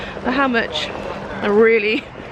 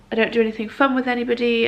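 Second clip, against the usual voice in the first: ordinary delivery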